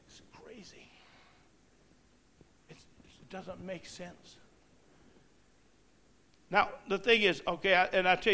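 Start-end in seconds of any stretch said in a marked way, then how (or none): 0.1-4.4 whisper. Real, not acted. Disbelief aside after radio story.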